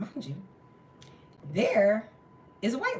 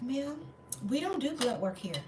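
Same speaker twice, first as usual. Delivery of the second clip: higher, softer mock-polite refusal; mimicked clerk; 7 semitones higher, 6 dB quieter than usual. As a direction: imitate a clerk gently turning someone away.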